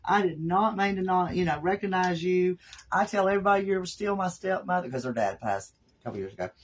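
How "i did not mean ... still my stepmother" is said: imitating stepdaughter. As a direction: play the sorry stepdaughter, pitched up. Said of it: "then my stepdaughter texted me".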